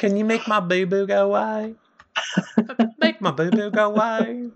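put-on high baby-talk whine; babyish Joe. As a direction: whimper it like a toddler wanting comfort. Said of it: "when Joe's like".

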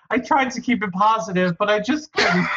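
high, quick words through laughter; laughing. Real, not acted. Host cracks up over a listener's comment, laughing. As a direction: barely hold it together, laughing.

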